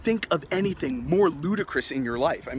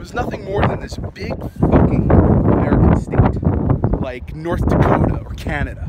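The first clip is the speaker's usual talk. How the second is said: loud, forceful outburst; angry rant; 10 dB louder than usual. Acted. Punk ranting about England to listener, loud angry outburst. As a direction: blast it out in contempt.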